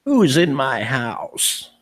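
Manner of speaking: slow, drawn-out gravelly crone; gravelly old woman. Retold or imitated. Investigator imitating the recorded voice in the bedroom, gravelly old woman. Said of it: "gravelly old woman's voice going".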